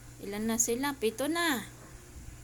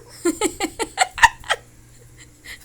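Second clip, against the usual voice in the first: high, loud burst of laughter; amused laughter; 7 semitones higher, 11 dB louder than usual. Real, not acted. Owner amused by the eldest dog, bursting into laughter. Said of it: "Natatawa ako sa iyong panganay".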